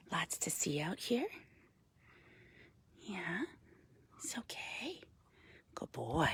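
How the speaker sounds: soft reassuring whisper; calming a panting raven with a whisper; soothing whisper. Real, not acted. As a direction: hush him gently, barely voiced.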